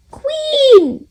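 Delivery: high, loud call. A narrator's reading. Susie Sheep calling for the Queen, high and loud. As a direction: call across the big palace. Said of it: "Susie Sheep calls".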